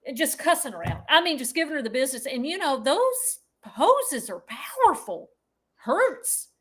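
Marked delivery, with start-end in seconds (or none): none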